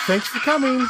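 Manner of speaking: imitated cooking-show hostess, high; Venetia. Husband imitating his wife's video greeting, high hostess voice. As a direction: mimic a perky video host's greeting. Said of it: "her Cooking with Venetia voice".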